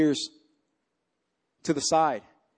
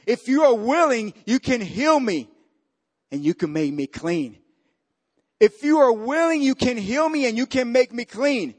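fervent preaching; 7 semitones higher than usual. Acted. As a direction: preach the leper's words with fire. Preaching the leper's plea.

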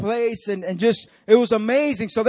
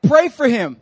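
the Lord's command; 3 semitones higher, 7 dB louder than usual. Retold or imitated. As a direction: give it as a firm, loud instruction. God's command in retold story.

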